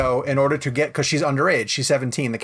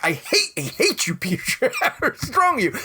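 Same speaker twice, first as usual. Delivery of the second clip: exasperated mock rant, voice rising higher; mock outrage; 7 semitones higher than usual. Yelling at the TV interviewer in retelling, mock outraged. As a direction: rise into high, indignant disbelief with a laugh. Retold outburst at Peter Travers.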